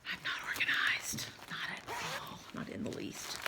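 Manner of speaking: whispered self-mocking confession; whispered aside. Real, not acted. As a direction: breathe it as a sheepish aside to yourself. Self-deprecating aside while sorting.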